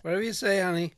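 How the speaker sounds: high, loud placating mom voice; mock agreeable mother; imitated mom. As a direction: humour your kid in a put-on motherly voice.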